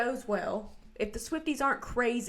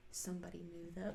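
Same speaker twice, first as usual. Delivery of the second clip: very soft, lower murmur; quiet aside imitation; 4 semitones lower, 15 dB quieter, faster than usual. Host quoting his reply to doubters, hushed. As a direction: drop right down for a low aside. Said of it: "He's like".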